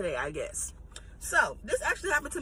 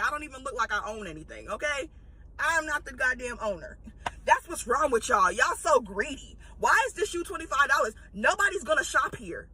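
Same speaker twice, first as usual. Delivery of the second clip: ordinary talk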